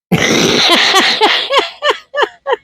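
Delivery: a long, high burst of real laughter; big high laugh. Real, not acted. Mother laughing at her son's phone prank. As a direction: break into helpless high laughter.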